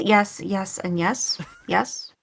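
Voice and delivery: usual speaking voice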